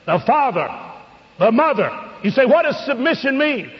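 high, slow, emphatic sermon cadence; impassioned preaching. Real, not acted. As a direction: preach each line with weight.

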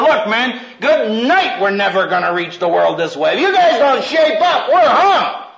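high, pressed scolding of students, mildly hammed; mock pep talk to lazy students; exasperated urging. Preacher retelling scolding his students, high and exasperated. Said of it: "I said".

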